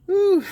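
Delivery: short high-pitched whoop of relief; high whew. Real, not acted. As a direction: let out a high "whew" after the effort. Relief after landing a flathead.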